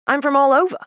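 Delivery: loud, high Australian twang; breezy Australian-accented answer. A narrator's reading. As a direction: pitch it up with an Aussie ending on "over". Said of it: "with an Australian twang".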